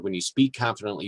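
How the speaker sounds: ordinary talk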